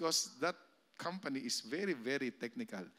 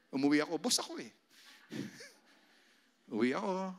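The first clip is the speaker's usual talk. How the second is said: ordinary delivery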